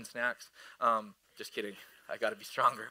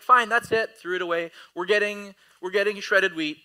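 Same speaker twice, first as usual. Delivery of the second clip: loud, raised-pitch mock exasperation; fed-up dad; 6 semitones higher, 7 dB louder than usual. Dad giving up on arguing with son, exasperated. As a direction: act out a parent whose patience ran out.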